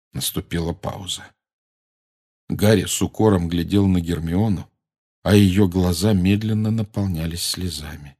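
plain reading voice

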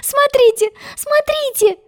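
put-on very high, excited girlish voice; delighted cry. A narrator's reading. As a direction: call out in squealing delight. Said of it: "крикнула она восторженно".

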